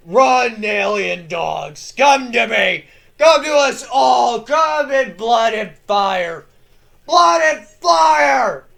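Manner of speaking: bellowing knight challenging the aliens; defiant war cry. Acted. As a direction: dare the horde to come at you. Grimaldus taunts the alien hordes.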